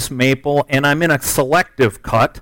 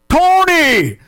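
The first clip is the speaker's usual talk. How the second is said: high, long call across the woods; 13 semitones higher than usual. Retold or imitated. Hunter calls his buddy for help, hollering. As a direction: holler the name for someone far off. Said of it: "Call for Tony".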